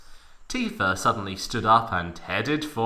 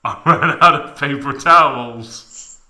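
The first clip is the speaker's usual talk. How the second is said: a put-on Cloud voice, louder; 3 semitones higher, 11 dB louder than usual. A narrator's reading. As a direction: voice Cloud as a different character.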